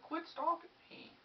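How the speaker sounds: high, slow mock woman's voice. Woman telling her stalker to quit, high slow mock falsetto. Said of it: "she was like".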